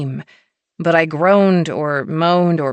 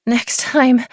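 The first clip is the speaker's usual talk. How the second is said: whined, higher; whiny plea; 8 semitones higher, 4 dB louder than usual. A narrator's reading. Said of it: "I whined".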